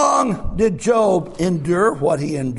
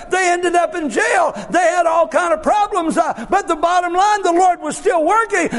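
full-force preaching; 8 semitones higher than usual. Acted. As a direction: preach it out to the whole church.

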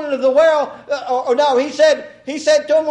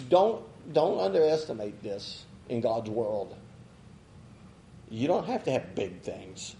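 much softer and lower, pressing the point gently; hushed earnest preaching; 12 semitones lower, 12 dB quieter than usual. Real, not acted. Preacher quietly urging the congregation that God wants willingness. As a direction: lean in and say it quietly so they listen.

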